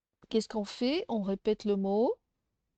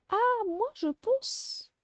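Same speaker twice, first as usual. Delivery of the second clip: put-on much higher, childlike pupil voice; teacher playing a pupil answering; pupil's voice; 11 semitones higher, slower than usual. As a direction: pipe up like an eager child. Voicing a pupil's reply.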